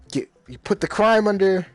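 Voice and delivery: ordinary talk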